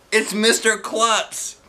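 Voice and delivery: alarmed. Acted. Kid alarmed at principal.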